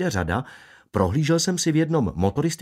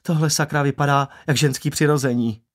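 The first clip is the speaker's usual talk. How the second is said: higher-pitched, blunt barber; blunt opinion; 4 semitones higher than usual. Acted. Barber judging a car design to a customer, bluntly. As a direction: give the verdict without sugar-coating, pitched up. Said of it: "vyjádřil své mínění bez obalu".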